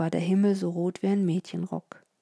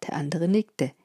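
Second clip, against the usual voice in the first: ordinary delivery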